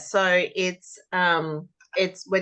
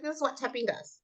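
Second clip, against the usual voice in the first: softer explanation; 7 semitones higher, 8 dB quieter than usual. Shown as a demonstration. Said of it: "I said".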